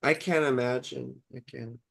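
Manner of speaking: low, soft, dying away; sincere sympathy. Real, not acted. Streamer to grieving parent in chat, real hushed sympathy. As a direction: let it sink to almost nothing. Condolence on a lost child.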